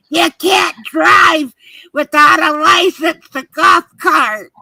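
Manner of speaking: loud, high mock shout, dragged out; retold yelling. Woman imitates son refusing her a golf cart, yelling. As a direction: imitate him hollering it, slowly. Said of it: "And he's yelling".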